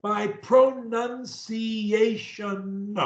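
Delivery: very slow, stretched-out syllables; careful pronunciation. Shown as a demonstration. Coach models lines for a stutterer to repeat, careful drawn-out pronunciation. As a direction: draw out every word with full articulation. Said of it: "pronunciation of every word".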